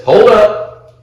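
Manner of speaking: loud, high mock-cop call. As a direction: imitate a cop ordering someone to stop. Retold cop calling out an order.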